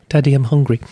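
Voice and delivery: playful toddler impression; imitating hungry child. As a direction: mimic a small child appealing to Daddy. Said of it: "if the child is hungry".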